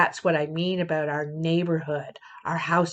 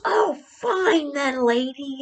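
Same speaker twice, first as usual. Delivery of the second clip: high, slow whiny fine then; imitating the cyclist giving up sulkily; sulky retort; 7 semitones higher, slower than usual. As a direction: pout it out like a sore loser. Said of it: "He goes".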